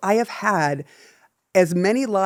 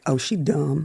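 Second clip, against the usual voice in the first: mock Southern; 4 semitones lower than usual. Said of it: "they're like".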